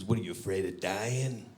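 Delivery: dropped-down taunt in a friend's voice; buddy's taunting voice. Retold or imitated. Buddy daring him onto a motorcycle, taunting. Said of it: "That's how he talks".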